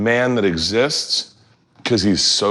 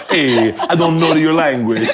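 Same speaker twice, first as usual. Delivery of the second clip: high, slow hammed-up Italian woman accent laughing; mock Italian woman accent; 6 semitones higher, 3 dB louder, slower than usual. Showing the guest how an Italian woman talks, hammed-up Italian accent. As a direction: camp up a fake Italian woman accent through giggles.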